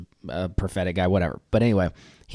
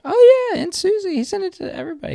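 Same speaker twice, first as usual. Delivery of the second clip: squealed excitement; 9 dB louder than usual. Real, not acted. Excited joking reveal.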